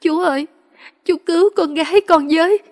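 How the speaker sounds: a desperate daughter crying out for help to her uncle; crying loudly. A narrator's reading. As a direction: beg through loud tears. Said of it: "khóc lớn".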